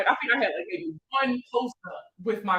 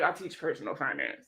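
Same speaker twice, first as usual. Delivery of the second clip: sheepish answer; 8 semitones lower, 8 dB quieter than usual. Retold or imitated. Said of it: "And I was like".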